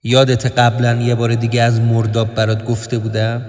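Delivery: low, level confiding voice; sombre inner narration. A narrator's reading. Narrator confides about sinking in a swamp, low sombre voice. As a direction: sink into a low, even monotone aside.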